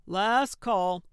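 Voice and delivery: loud holler, voice projected. A narrator's reading. Bartender hollers closing time to the bar, hollering. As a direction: call it out loudly across the room. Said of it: "the bartender rang a bell and hollered".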